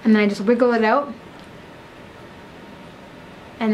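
speaking around metal key; talking with tool in mouth. Real, not acted. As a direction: mouth held open. Seen 0: Key held at lips, then fully inside the open mouth 5.1–6.7.

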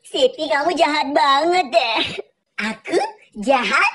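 normal talking voice

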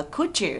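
"could you" blended as "coul-joo"; linked sounds. Shown as a demonstration. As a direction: merge the d and y into a j sound. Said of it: "đọc nối cái âm d".

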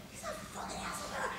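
속삭이는 험담; whispered aside. Retold or imitated. Muttered thought about director.

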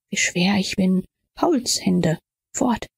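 plain reading voice

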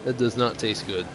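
sick, just puked a little; queasy. Real, not acted. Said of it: "I puked in my mouth".

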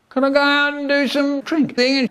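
put-on high squeaky boy; squeaky kid voice. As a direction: pipe it up like a little kid. Boy's squeaky question.

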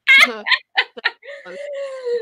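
loud, high-pitched laughing fit; high laughter. Real, not acted. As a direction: burst out in a long, high laugh. Laughing at friend's accent.

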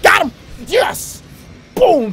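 high triumphant yell; shout. Real, not acted. Gamer cheering his own goal, triumphant shout.

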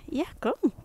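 high, coaxing call; high dog call. Real, not acted. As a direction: coax the dog to come. Calling dog in baby voice.

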